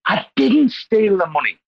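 "didn't" punched high, falling away after; high, emphatic denial on "didn't"; stress on DIDN'T. Shown as a demonstration. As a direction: hit the word "didn't" hard. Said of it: "with five different, like, word stresses".

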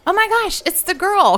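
high, starstruck gasp of recognition; thrilled to recognise her. Retold or imitated. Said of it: "your clients were like".

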